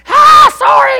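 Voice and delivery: put-on high, very loud yell of an upset little girl; imitated child's yell. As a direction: shriek it like a daughter freaking out at her brother. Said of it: "she starts yelling at him".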